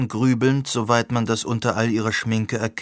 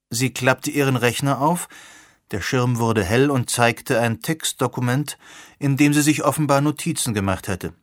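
ordinary delivery

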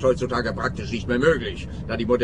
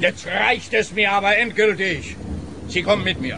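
angry; 6 semitones higher than usual. Acted. Inspector loses patience.

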